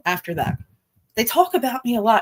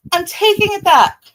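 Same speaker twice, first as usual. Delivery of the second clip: much higher, loud mock retraction; pulling a Shelly, bigger; 9 semitones higher, 4 dB louder than usual. Mimicking Shelly's backpedal, pitched way up and loud. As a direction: go way up, hammed-up reversal. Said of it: "I'm gonna pull a Shelly right now".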